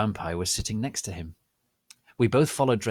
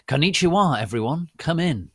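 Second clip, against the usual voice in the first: higher, louder bubbly hello; cheery greeting; 7 semitones higher, 6 dB louder than usual. Acted. Teacher greeting the class with a lightly sunny hello. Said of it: "said Professor Sinister in Japanese".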